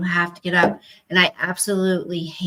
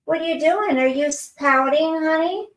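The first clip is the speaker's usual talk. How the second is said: high cooing question; 7 semitones higher than usual. Real, not acted. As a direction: coo at a sulking pet. Talking to pet.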